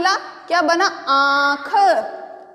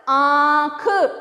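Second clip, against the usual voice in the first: slow sounding out; much slower than usual. Shown as a demonstration. Teacher showing children how आँख is pronounced, very slowly. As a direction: say आँख very slowly, letting the nasal आँ ring. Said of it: "तो इनका किस तरह से उच्चारण करेंगे".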